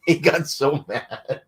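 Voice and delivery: drawn out through laughter, pitched high; real giggle running through slow words; laughing. Real, not acted. Recalling a prank on Wagon, real laughing.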